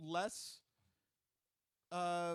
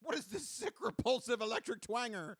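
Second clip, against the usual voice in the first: angry dad; 4 semitones higher, 5 dB louder than usual. Acted.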